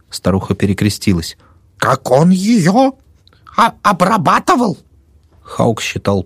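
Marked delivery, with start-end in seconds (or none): 1.8-4.8 put-on old woman voice.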